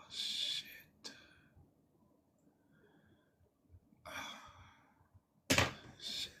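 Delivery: real whispered curse; very soft, whispered dismay; whispered swearing. Real, not acted. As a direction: swear under the breath, voiceless. Muttered reaction to a rough story.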